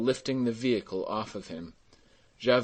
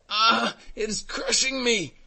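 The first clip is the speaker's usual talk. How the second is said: very high, agonized cry; crying out in pain; 12 semitones higher, 4 dB louder than usual. A narrator's reading. Old carter under sinking cart, crying out. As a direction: wail as the weight presses down. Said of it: "cried the old man".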